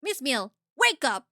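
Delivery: loud yell to wake her; shout. Acted. Detective yells at the sleeping attendant, loud. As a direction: bark it to jolt her awake.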